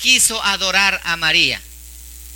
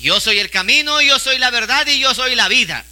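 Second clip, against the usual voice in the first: higher, slowing to a deliberate, challenging finish; defiant proclamation; 4 semitones higher than usual. Retold or imitated. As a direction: proclaim Jesus's words as a challenge. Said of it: "dijo esas palabras más desafiantes".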